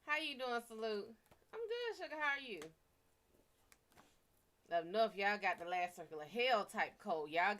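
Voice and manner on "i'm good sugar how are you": much higher, sugary hello; sweet high greeting. Real, not acted. Streamer greeting a chat viewer, sugary high voice. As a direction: coo it to a viewer.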